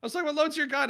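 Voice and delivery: high, fast, rushed retelling; retold excited rambling. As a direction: babble excitedly. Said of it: "And I was like".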